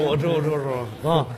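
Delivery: usual speaking voice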